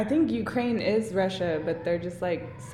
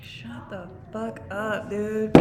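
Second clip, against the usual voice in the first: whispered retort; 5 dB quieter, slower than usual. Retold or imitated. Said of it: "I was like".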